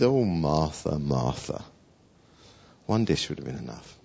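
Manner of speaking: gentle affectionate address to a fussing friend; tender. Acted. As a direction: say the double name like a loving sigh. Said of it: "Jesus does that so much".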